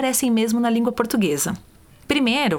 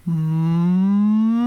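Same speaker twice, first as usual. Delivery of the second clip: gliding hum; 4 dB louder than usual. Shown as a demonstration. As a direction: slide the hum up through the range. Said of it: "Mais ou menos assim".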